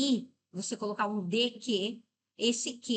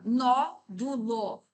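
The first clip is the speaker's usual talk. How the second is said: syllable by syllable; much slower than usual. Shown as a demonstration. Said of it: "Vamos pra última".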